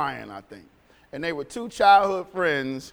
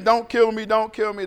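mild mock scream, raised pitch; half-shouted, higher imitation of screaming; retold screaming; 5 semitones higher, 5 dB louder than usual. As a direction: imitate a coward begging for his life. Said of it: "he's screaming".

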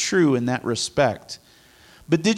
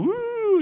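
high sustained "woo"; airy, drawn-out woo poking fun at mysticism; mock spooky woo. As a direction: sing out a high, floaty woo. Mocking airy spirituality.

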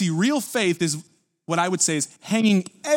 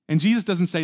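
ordinary delivery

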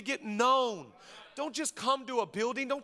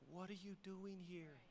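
softer, low-key voice of God; God's gentle question; 3 semitones lower, 21 dB quieter than usual. Retold or imitated. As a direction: ask it softly as God. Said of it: "God's like".

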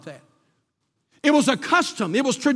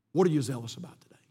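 quick low question; 9 semitones lower, 8 dB quieter, faster than usual. Shown as a demonstration. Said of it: "preacher asks the question twice".

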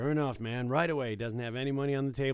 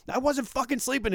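high, loud mock rant; angry yell; 13 semitones higher, 6 dB louder than usual. Dealer mimicking the veteran's renewed rant, loud mock yell. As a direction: rant it like a cornered drunk.

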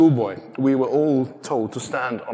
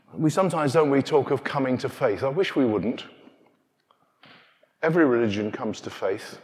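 ordinary talk, nothing marked

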